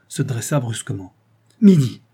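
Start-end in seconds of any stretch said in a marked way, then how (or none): 1.6-2.0 loud, much higher sudden cry; alarmed cry. A narrator's reading. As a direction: blurt out the hour in alarm. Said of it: "s'écria-t-il". (s'écrie l'heure, alarmé et fort)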